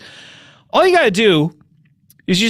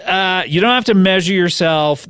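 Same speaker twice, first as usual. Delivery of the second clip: ordinary talk, nothing marked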